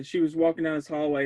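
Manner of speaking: ordinary talk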